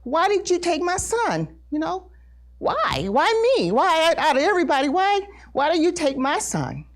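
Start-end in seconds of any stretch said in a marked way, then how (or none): 0.0-1.5 high, aggrieved demand; retold angry protest, pitched high; mad. Grieving mother questioning God, mad. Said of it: "I was mad". 2.6-6.9 high, insistent pleading demand; retold angry why, pitched up and rushing midway; mad. Grieving mother asking God why her, mad. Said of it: "I was mad".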